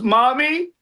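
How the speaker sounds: high, rising mock-tearful call; crying man's "Mommy". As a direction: imitate a grown man calling for mommy. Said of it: "Anytime a guy cries and goes".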